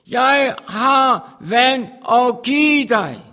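slow, loud, drawn-out proclamation; proclaimed. Acted. Preacher voicing Jesus's offer, slow and booming. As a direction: stretch each word like a call from on high.

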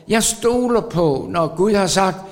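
repeated "Jeg stoler på" climbing in force; preaching at full force. Acted.

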